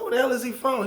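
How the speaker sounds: high-pitched, puzzled demand; acted incredulity. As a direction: ask in disbelief, voice jumping up. Acted puzzled question about stranger.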